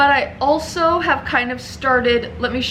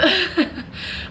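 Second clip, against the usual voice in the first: high giggle; 6 semitones higher than usual. Real, not acted. Laughs about deciding both.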